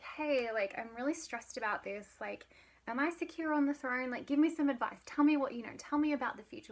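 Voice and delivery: lightly acted urgent demand for reassurance; mildly stressed pleading. Macbeth pestering the witches for advice, mock-stressed pleading.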